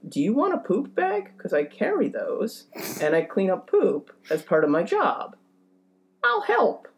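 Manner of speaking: high, overly sweet helpful offer; hammed-up accommodating dog walker; people pleaser. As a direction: chirp it eagerly to please. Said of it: "I went".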